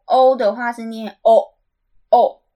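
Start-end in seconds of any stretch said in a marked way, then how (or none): 1.2-2.4 "O" said loud and drawn out as a stressed vowel. Shown as a demonstration. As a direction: hit each "O" hard and hold it. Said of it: "泽奥利其实是重音". (把“O”念成响亮拉长的重音)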